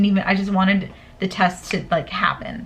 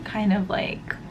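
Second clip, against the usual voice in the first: ordinary talk, nothing marked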